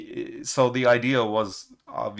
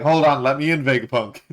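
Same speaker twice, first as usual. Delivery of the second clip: Sanji yelling; 4 semitones higher, 8 dB louder than usual. Retold or imitated. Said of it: "he's like".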